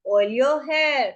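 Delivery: drawn-out teaching delivery; slow demo phrase; slow model phrase. Shown as a demonstration. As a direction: stretch it so learners can follow. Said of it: "बोलते ना".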